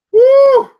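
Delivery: high loud whoop of triumph; sudden joyful yelp; excited woo. Real, not acted. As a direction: whoop like you just won. Excited yelp over hard word.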